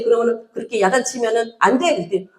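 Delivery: normal talking voice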